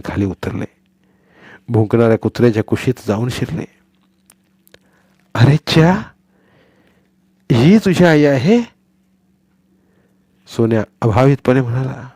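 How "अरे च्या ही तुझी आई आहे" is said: put-on slow, higher boy's voice in wonder; surprised boy. Boy Sonya surprised the barking dog is the puppy's mother.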